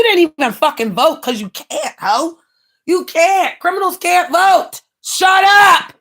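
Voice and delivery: heated rant. Real, not acted. Ranting about a rival to viewers, rising shout.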